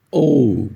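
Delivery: O said like calling out to someone; English letter O. Shown as a demonstration. Teacher demonstrates the letter O, like calling a person. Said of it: "não é o, mas é".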